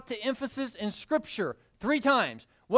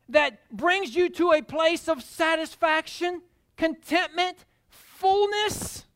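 fervent preaching; 3 semitones higher, 4 dB louder than usual. Acted. Preacher exalting joy and grace to the church. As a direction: pour it out to the congregation.

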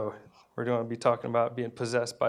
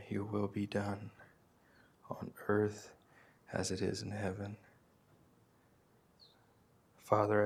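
hushed prayer; 2 semitones lower, 8 dB quieter than usual. Acted. Pastor praying over his congregation, soft and hushed. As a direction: pray quietly and tenderly, as if speaking close to God.

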